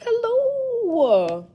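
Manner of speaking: high, slow sweet greeting; imitating her again. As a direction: coo it like a sweet lady. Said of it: "She like".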